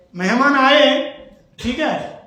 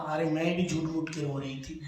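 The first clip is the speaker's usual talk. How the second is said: low, soft giggly wife's reply; wife laughing to her husband, soft and low; laughing confession; 7 semitones lower, 11 dB quieter than usual. Retold or imitated. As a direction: let the laugh slip through the line. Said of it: "तब पत्नी ने कहा".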